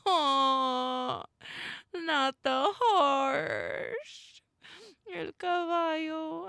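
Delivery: high, loud, slow anguished plea; distressed wail. Real, not acted. Horse-loving player hearing a horse scream, anguished. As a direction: cry out for the poor horse.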